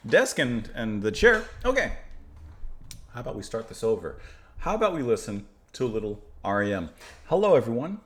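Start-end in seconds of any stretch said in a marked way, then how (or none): none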